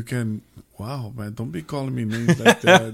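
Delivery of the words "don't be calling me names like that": laughing through a mock complaint; half-laughing mock protest. Real, not acted. Mock offended by a word.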